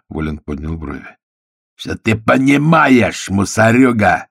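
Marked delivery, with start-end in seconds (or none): 1.8-4.3 slow, loud, high angry snarl; snarling rage. A narrator's reading. The bandit snarls furiously at Volin, slow and loud. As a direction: spit it out at the cop in fury. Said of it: "вызверился голос".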